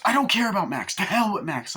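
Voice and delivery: high loud mock tantrum; mock outburst. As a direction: snap it like a teen who's fed up. Angry retold outburst.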